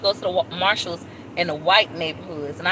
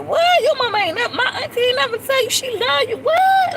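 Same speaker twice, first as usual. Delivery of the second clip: much higher, squealing disbelief; shocked; 5 dB louder than usual. Retold or imitated. Vlogger retelling her reaction to a relative, shocked squeal. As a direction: cry out as if you can't believe it. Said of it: "Like, shocked".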